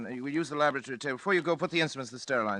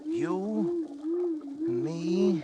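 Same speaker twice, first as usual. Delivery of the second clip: much higher, louder, exultant; triumphant; 6 dB louder, slower than usual. Acted. Doctor gloating to colleague over living brain, exultant. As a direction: crow over the discovery.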